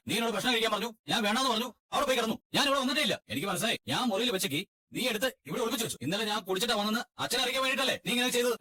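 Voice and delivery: high-pitched, heated accusing rant; angry accusation. Acted. Husband accusing his wife of hiding the car key, angry and high. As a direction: throw the blame at her, voice climbing.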